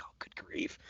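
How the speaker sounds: whispered, exasperated aside; soft whispered groan; whispered dismay. Retold or imitated. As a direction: whisper it in despair. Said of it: "I'm like".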